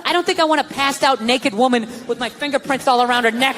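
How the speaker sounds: ordinary talk, nothing marked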